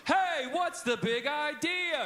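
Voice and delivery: high, drawn-out holler; slow, high shout of the cue; hollered question. Shown as a demonstration. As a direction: call it out to the whole room. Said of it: "if I holler out".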